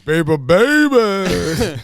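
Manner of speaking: put-on very slow, high, loud drawn-out baby; hammed-up baby voice; mock pleading. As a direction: tease him like a scared child. Friend mocks the scared boy.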